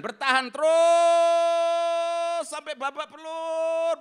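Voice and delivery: loud, high, very slow climax; impassioned preaching. Acted. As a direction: pound out the suffering. Intense preaching.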